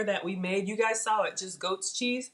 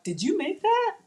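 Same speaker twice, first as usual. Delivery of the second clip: quoting people, pitched high in wonder; asking in disbelief if she cooked it; high, surprised quoted question; amazed question; 12 semitones higher than usual. Retold or imitated. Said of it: "people would be like".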